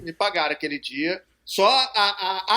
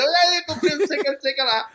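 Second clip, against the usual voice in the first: mocking teasing voice; 7 semitones higher, 3 dB louder, faster than usual. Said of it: "ficou de brincadeirinha comigo".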